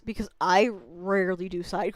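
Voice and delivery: ordinary talk, nothing marked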